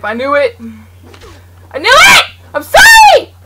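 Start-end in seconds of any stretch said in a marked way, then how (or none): 1.7-3.3 higher and louder, shrieked apology; panicked shout. Real, not acted. Found by the killer, screaming apology. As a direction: scream as he's caught.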